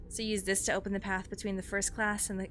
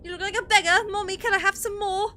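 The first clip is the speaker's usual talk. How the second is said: put-on high child voice; 12 semitones higher, 11 dB louder than usual.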